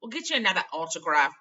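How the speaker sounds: loud, pushing it through; impatient Ron. Acted. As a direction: brush her off.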